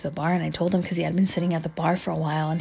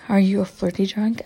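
usual speaking voice

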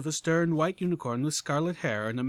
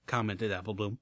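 usual speaking voice

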